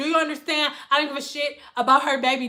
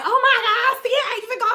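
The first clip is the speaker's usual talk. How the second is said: high client voice; 10 semitones higher, faster than usual. Retold or imitated.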